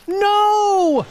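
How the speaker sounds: sharp high warning cry; shouting. Acted. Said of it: "shouted Bartholomew".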